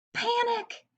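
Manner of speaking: high, frightened hen's yelp; a sudden squeal of fright; mild mock panic, pitched high; panicked cry. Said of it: "She was really scared".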